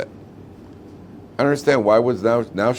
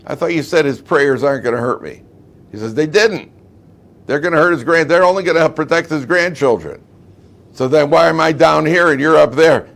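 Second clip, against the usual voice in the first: raised, louder indignant protest; acted protest; 7 semitones higher, 5 dB louder than usual. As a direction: argue up from the bottom of a hole. Lion in hole quarrels with fox.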